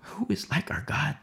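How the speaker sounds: unvoiced, hushed worship; reverent whisper. Real, not acted. Preacher speaks of God's strength, in a reverent whisper. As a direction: whisper it like a prayer.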